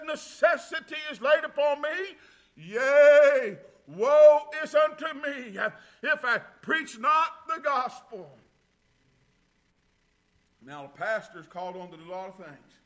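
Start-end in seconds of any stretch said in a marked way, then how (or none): 0.1-8.4 preached quote. Retold or imitated. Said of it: "he said".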